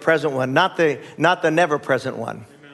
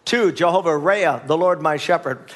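ordinary delivery

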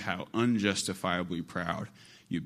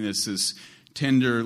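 ordinary delivery

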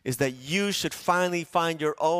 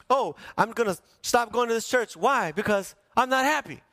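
higher mock complaint, lightly voiced; whiny excuse; 8 semitones higher than usual. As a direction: imitate a churchgoer's petty excuse. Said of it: "the reason that we give".

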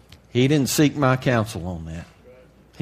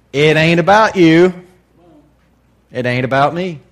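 loud declaration; 6 semitones higher, 10 dB louder than usual. Real, not acted. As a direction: thunder "It ain't about you" at them. Preacher declaring to congregation.

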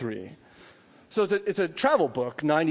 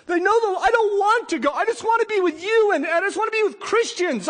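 much higher, strained pleading; pleading man; 6 dB louder than usual. Acted. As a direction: plead not to be sent back home. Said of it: "And I wonder if he thought".

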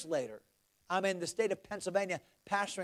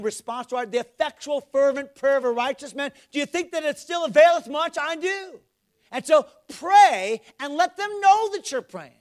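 raised, building preacher's exhortation; forceful preaching; 6 semitones higher, 9 dB louder than usual. Real, not acted. Preacher urging the congregation to pray, fervent and loud. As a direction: press the congregation, louder toward the end.

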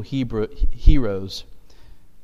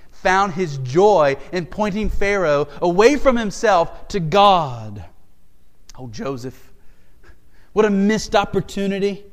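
louder, driving pulpit delivery; preaching; 4 semitones higher, 5 dB louder than usual. Acted. Pastor urging the church to give God glory, preaching. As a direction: press the point home to the church.

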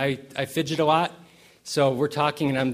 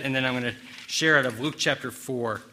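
normal talking voice

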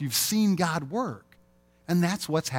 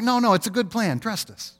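higher, breezy dismissive reassurance; 9 semitones higher than usual. Retold or imitated. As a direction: brush off the worry like it's nothing. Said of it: "They're like".

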